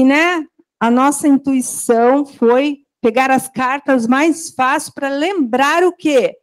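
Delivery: ordinary talk, nothing marked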